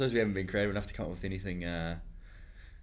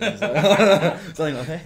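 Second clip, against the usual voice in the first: real laughter breaking through, voice jumping higher and louder; laughing; 9 semitones higher, 14 dB louder, much faster than usual. Real, not acted. Host laughing at his own fake Kiwi line, higher and louder. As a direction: laugh it out, pitch up high.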